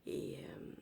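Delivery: breathy whisper; whispering, barely voiced. Real, not acted. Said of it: "on reparlera quand je vais avoir une vraie voix".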